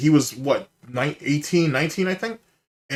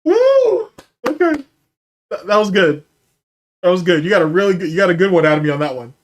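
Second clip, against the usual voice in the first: loud, raised voice coming down from laughter; real laughing fit; 5 semitones higher, 6 dB louder than usual. Real, not acted. Streamer praising a viewer's joke, still tickled. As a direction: recover from cracking up. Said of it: "I'm still laughing at the first one".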